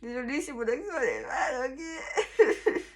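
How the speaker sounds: high, laughing plea; laughing apology. Real, not acted. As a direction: apologise through helpless giggles. Embarrassed apology for her Korean accent.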